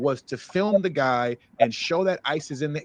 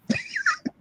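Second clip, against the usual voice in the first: laugh; 4 dB quieter than usual. Real, not acted. Laughs after signing off.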